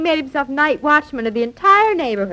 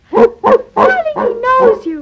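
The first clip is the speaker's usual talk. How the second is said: delighted; 8 semitones higher, 6 dB louder, much slower than usual. Acted. Wife delighted dog knows husband.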